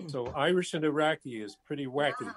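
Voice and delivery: usual speaking voice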